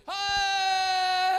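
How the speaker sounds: long high wailing hai; cry of hai. Shown as a demonstration. Preacher showing a far-off cry, long loud wail. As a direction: stretch out a loud anguished hai.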